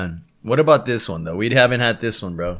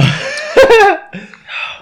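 high laugh; 8 dB louder than usual. Real, not acted. Laughing at his own state.

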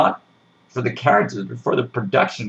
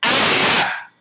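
mock shriek; 12 semitones higher than usual.

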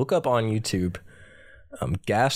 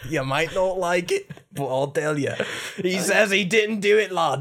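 higher mock Scottish storyteller; Scottish accent; 8 semitones higher than usual. Friend continuing the bit, higher mock Scottish menace. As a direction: spin it like a menacing old Scot. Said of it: "when I do a Scottish".

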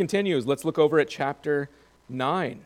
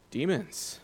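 usual speaking voice

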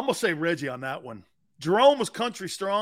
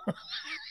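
high squeak of laughter; 12 dB quieter than usual. Real, not acted. Laughing at memory.

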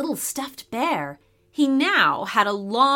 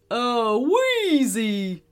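teddy bear shouting; much slower than usual. A narrator's reading. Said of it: "shouted the teddy".

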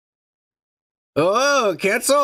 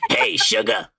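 put-on old lady voice; 7 semitones lower than usual. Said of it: "That Rogue's gonna sound like me".

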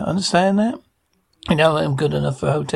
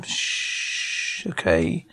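hush; 11 semitones lower, 7 dB quieter, much slower than usual. Acted. Hushing the baby again.

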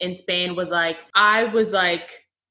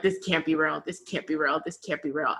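hushed, dazed repetition in a lower voice; stunned disbelief; 4 semitones lower, 8 dB quieter than usual. Retold or imitated. Friend recalling election morning, quiet stunned disbelief. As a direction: murmur it like you can't take it in.

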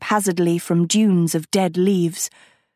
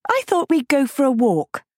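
put-on higher, breezy girlish voice; bright girl; 7 semitones higher than usual. A narrator's reading. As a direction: lift it up, all innocence. Sophie's bright voice.

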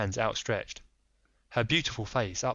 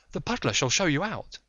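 higher, anxious noblewoman voice; lady pleading secrecy; Lady Hilda; 7 semitones higher, 3 dB louder than usual. Acted. Frightened wife to Holmes, higher voice.

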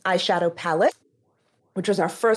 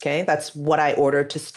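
normal talking voice

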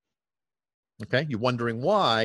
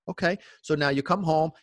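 ordinary talk, nothing marked

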